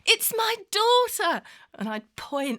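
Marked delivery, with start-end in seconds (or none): 0.0-1.4 high, drawn-out loud call, voiced as the father; imitated father's cry. Confused dad announces his daughter, high drawn-out call. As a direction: cry it out slowly, pitched way up.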